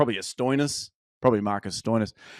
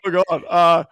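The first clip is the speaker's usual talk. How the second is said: higher and louder, flustered and caught red-handed; 5 semitones higher, 6 dB louder than usual. Retold or imitated. Caught in the borrowed jumper by a neighbour, flustered. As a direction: blurt it out like you've just been caught. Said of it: "I was like".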